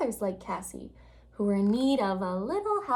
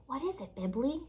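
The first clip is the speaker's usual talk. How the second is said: whispered; 3 semitones higher, 7 dB quieter than usual. Acted. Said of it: "she whispered".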